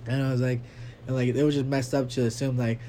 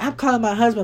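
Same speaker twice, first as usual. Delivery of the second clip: threatening mom; 9 semitones higher, 6 dB louder than usual. Retold or imitated. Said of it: "she's like".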